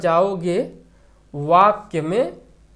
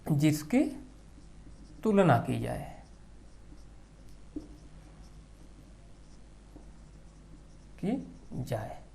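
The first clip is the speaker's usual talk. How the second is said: softer, slower repeat for note-taking; drawn-out dictation, trailing quieter; 3 semitones lower, 14 dB quieter, slower than usual. Shown as a demonstration. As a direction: say it again slowly so they can write it down. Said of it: "उपमेय मतलब".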